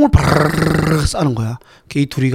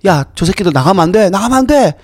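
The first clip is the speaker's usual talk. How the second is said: rising higher and louder into a yell; frantic ordering shout; 10 semitones higher, 4 dB louder than usual. Retold or imitated. Lab boss orders the tunnel destroyed, rising shout. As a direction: build from urgent to panicked shout. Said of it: "이렇게 해요".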